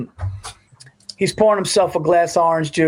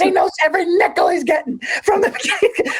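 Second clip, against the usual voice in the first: higher mock shout; radio host shouting about the coach, higher; Mad Dog shouting. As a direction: yell it like a ranting host. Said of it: "He goes".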